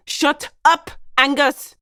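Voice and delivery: loud, high outburst to silence someone; angry shout. Acted. Speaker silences Angus mid-scene, angry shout. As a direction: shout him down hard.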